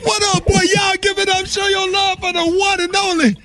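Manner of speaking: high, hyped announcer shout-out; radio host hype intro. Acted. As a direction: hype the guest like an MC. Host announcing caller on air.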